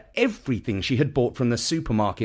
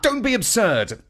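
worked-up Dad; 11 semitones higher than usual. A narrator's reading. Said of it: "now getting quite worked up".

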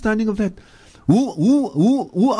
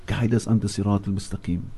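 a quiet, inward prayer to God; soft repeated plea; 10 semitones lower, 5 dB quieter than usual. Real, not acted. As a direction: lower and much softer, fading. Closing plea repeated softer.

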